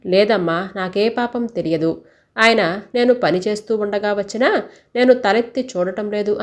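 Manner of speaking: usual speaking voice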